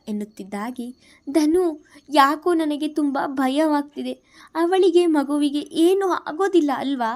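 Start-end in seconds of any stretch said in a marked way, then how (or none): 1.3-7.1 high, louder frightened questioning; a frightened mother needing reassurance; anxious. Acted. New mother asking her brother about her baby, anxious and high. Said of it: "ಆತಂಕದಲ್ಲಿಯೇ ಕೇಳಿದಳು".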